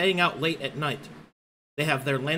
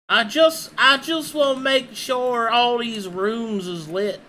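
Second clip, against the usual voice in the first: silly sing-song voice; 7 semitones higher, 6 dB louder than usual. Pretend. Playful voice while lighting lanterns.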